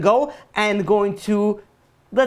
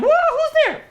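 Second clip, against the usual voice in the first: very high, startled squawk; startled mock cry. Pharaoh caught by Moses in the bathroom, comic startled cry. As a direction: yelp as if caught in private, hammed up.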